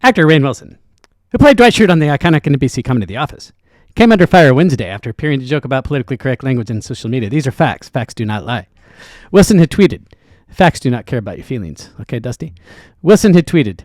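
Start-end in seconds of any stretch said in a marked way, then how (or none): 0.0-11.9 rapid-fire, louder news-read parody of a fast pundit; Ben Shapiro impression. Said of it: "How about you do it in Ben Shapiro's voice". 13.0-13.8 louder, clipped pundit news read; resume the fast pundit voice; Ben Shapiro impression. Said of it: "How about you do it in Ben Shapiro's voice".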